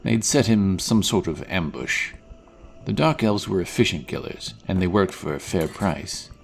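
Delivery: ordinary talk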